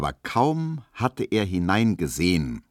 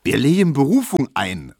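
loud, raised-pitch proclamation; calling out; 4 semitones higher, 7 dB louder than usual. A narrator's reading. Tesch taking up Kubinke's case, calling out. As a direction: belt it out as a rallying cry. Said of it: "rief Tesch wieder".

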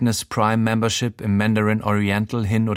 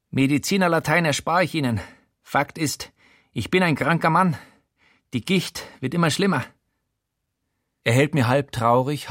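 higher, tired voice of an ailing big man; lightly voiced ailing politician; weary politician confessing; 4 semitones higher than usual. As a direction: confess your sickness, half bitter. Voiced figure Showalter confessing.

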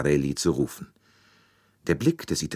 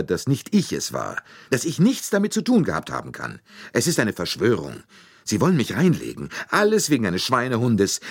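high, heated self-defence; agitated protest; 6 semitones higher, 3 dB louder than usual. A narrator's reading. Accused Italian defends himself to detective, agitated. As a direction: protest your innocence in rising agitation. Suspect desperately protests his innocence.

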